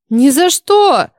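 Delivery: put-on loud, high, drawn-out girl's cry; defiant outcry; shouted refusal. A narrator's reading. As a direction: refuse with a slow, emphatic shout. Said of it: "закричала Грета".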